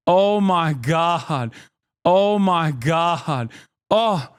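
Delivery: slow, drawn-out words; savouring amazement. Real, not acted. Streamer relishing a debate takedown, slow savouring. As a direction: stretch each word out, relishing it.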